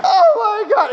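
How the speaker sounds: mock sobbing awe. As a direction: wail it like joy too big to hold. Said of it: "he breaks down crying, he says".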